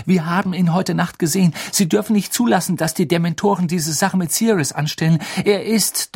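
rising, insistent plea; breathlessly pressing the case; urgent pleading. A narrator's reading. Boy pleading urgently with the Minister.

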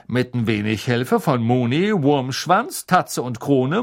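ordinary delivery, nothing marked

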